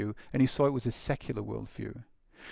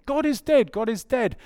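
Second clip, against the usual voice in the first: a high cry of a madman; 9 semitones higher than usual. Acted. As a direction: wail it into the churches. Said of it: "he runs into the churches".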